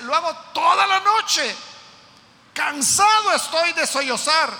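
preached lament, voice lifted high; anguished lament. Acted. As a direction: cry it out as one worn down by weeping. Preacher voicing the weeping psalmist.

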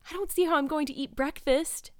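high, carried-away delight; rapturous. A narrator's reading. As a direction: say it in raptures, too thrilled to eat. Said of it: "said Anne rapturously".

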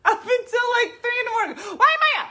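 high, loud laughing rant; giddy laugh-talk about staying up. Real, not acted. Laughing about energy drink.

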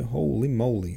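plain reading voice

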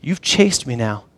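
soft, low and intimate; hushed, sincere one-on-one appeal; heart-to-heart question. Acted. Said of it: "he wanted them one last time saying".

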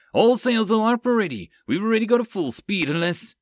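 high, loud and hurried; yelling. Acted. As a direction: report in a rush. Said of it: "first officer yelled out".

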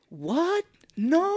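high, drawn-out mock protest; hammed-up disbelief, wailing upward; Mark Wahlberg. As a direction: stretch the cry high and overdone. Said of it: "another great line where he's like".

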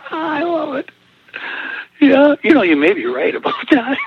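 high-pitched, helpless laughter through the words; laughing. Real, not acted. Husband amused by advice about his wife, laughing hard. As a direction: talk through real laughter.

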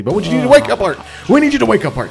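shouting to wake someone; loud wake-up call. Pretend. Calling loudly to wake Art.

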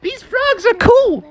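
very high, squeaky youngster voice; little wolf pups. Acted. Wolf children ask Dad about frogs, eager squeak. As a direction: pipe up eagerly to your father.

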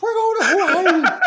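put-on very high, loud drunken whoop; drunk whooping crew. Drunk crew bragging to Bob about Hawaii, high whooping glee. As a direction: whoop it up like tipsy partygoers.

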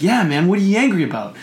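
match an angry friend's intensity; matched anger. Shown as a demonstration. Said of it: "match their tone".

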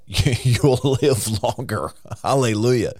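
slow, laughing through the words; amused chuckle. Real, not acted. Preacher tells listeners they'll live longer, chuckling.

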